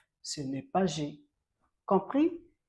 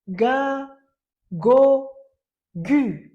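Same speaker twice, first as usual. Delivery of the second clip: ga go gu higher and louder; teacher and pupil chanting ga go gu; loud joint drill; 7 semitones higher, 9 dB louder, much slower than usual. Shown as a demonstration. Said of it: "nous deux".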